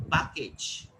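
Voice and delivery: "package" with open "ah" in first syllable; Indian pronunciation. Shown as a demonstration. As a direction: say "package" as "pah-kage". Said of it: "most of the Indians are using".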